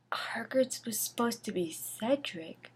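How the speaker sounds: soft whispered correction; a quiet muttered correction to the listener; whispered aside. Real, not acted. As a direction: lean in and whisper the fix. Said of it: "aside correcting the text".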